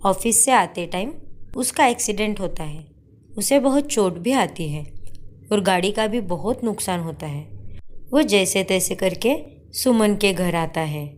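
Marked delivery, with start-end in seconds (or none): none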